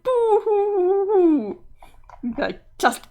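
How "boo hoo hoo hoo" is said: slow, high, loud cartoon wail of boo hoo; hammed-up boo-hoo crying. Sprite wailing at the friend who won't stop her, slow hammed-up boo-hoo. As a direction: wail it out like a theatrical crybaby. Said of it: "you're crying".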